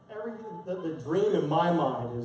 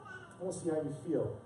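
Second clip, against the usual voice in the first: softer, calm, knowing voice of God; quiet voice of God; 10 dB quieter than usual. Retold or imitated. God calmly calling the proud pastor aside, softly. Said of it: "And God was like".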